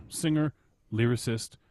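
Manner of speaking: ordinary talk, nothing marked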